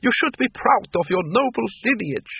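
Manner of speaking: lofty high-pitched vampire lady; haughty. A narrator's reading. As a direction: lecture with aristocratic pride. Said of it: "said Doreen haughtily".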